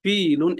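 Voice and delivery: ফিলুন slowed, with a held "ee"; long drawn vowel. Shown as a demonstration. Teacher repeating the word for students, ফিলুন with a long "ee". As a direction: draw out the first vowel in ফিলুন. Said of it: "টান দিতে হবে".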